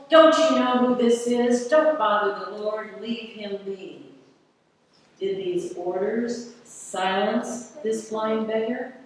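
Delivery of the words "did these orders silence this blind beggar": ordinary delivery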